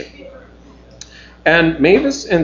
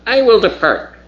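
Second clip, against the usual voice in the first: Cebus; 9 semitones higher than usual. Acted. Alien Cebus speaking telepathically.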